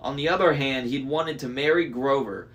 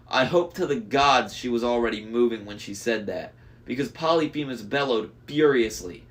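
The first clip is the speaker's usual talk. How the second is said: ordinary delivery, nothing marked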